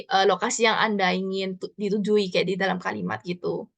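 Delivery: usual speaking voice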